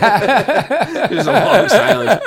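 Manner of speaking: slow, high, loud laughing words; laughing too hard to get it out; slowed words through real laughter. Real, not acted.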